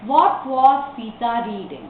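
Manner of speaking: slow, evenly connected question; model reading. Shown as a demonstration. Teacher models a question for the class, slow reading. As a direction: read it slowly as one whole sentence.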